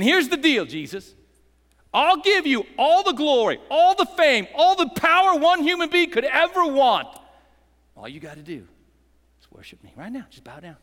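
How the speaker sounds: Satan's offer. Acted. Preacher voicing Satan's offer.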